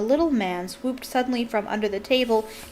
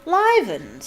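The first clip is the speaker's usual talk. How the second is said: fake astonishment; 5 semitones higher, 5 dB louder than usual. A narrator's reading. Sir Patrick fake astonishment.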